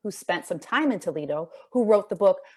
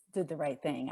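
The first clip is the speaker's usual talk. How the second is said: ordinary delivery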